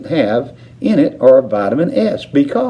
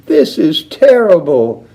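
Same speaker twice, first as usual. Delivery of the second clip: wailing; 7 semitones higher, 5 dB louder than usual. A narrator's reading. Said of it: "wailed Missus Teavee".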